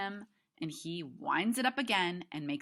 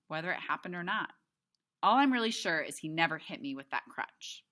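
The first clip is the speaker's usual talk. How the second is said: usual speaking voice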